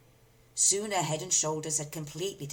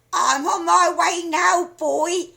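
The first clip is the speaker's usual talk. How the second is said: put-on high, loud, slow screechy old woman's call; crone calling; 12 semitones higher, 13 dB louder, slower than usual. A narrator's reading. Grandma calling down to George, screechy call. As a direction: call down like a gleeful hag. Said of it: "she called down to George".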